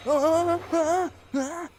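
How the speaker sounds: high, loud panicked yelps; panicked shrieking. Real, not acted. Panicked reaction.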